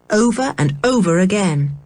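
ordinary delivery, nothing marked

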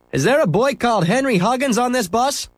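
a policeman calling into the bus; policeman asking. Acted. Policeman asking the passengers for Henry, firm. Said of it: "he asked".

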